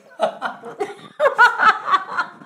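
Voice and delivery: high burst of laughter; loud laugh. Real, not acted. Host laughing at goodbye.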